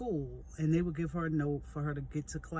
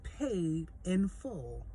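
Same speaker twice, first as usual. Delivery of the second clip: slow emphasis; much slower than usual. Retold or imitated. Repeating the dentist's blessing.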